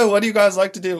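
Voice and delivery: a hint of a chatty character voice, quick; mock on-set character. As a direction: lightly play a character making small talk. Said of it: "being like".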